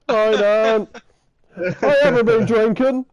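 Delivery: put-on high, slow, overly innocent drunk trying to sound sober; high overly polite drunk pretending to be fine; faking sober. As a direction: put on a sweet sober voice for nan. Drunk teen faking sober to nan.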